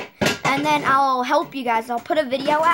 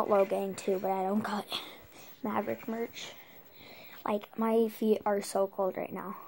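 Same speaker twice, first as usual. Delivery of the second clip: whispering; 4 semitones lower, 8 dB quieter than usual. Real, not acted. Whispered aside.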